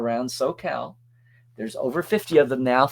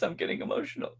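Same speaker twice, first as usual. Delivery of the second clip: mock choked up; 13 semitones higher, 9 dB quieter, faster than usual. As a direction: voice giving out, high and faint.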